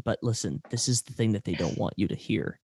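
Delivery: soft conspiratorial hush; a hushed secret for listeners; whispering. Pretend. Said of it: "it's to get people's attention".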